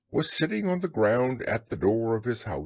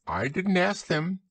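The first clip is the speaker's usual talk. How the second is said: high, offhand rabbit voice; lightly dismissive in a higher pitch; careless Rabbit; 6 semitones higher than usual. A narrator's reading. Rabbit explains the hangers-on, carelessly. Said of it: "explained Rabbit carelessly".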